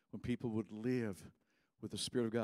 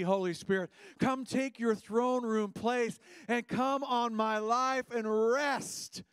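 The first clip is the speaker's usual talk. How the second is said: building from raised voice to high, loud plea; fervent prayer; 5 semitones higher, 5 dB louder than usual. Acted. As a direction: cry out a swelling invitation to the Spirit. Voices people's prayer, building fervour.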